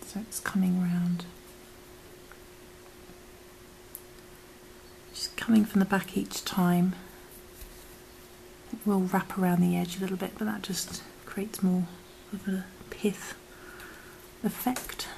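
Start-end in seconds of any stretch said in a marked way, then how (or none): 0.1-6.9 whispering. Acted. Whispered step. 10.4-15.1 whispering. Acted. Whispered step.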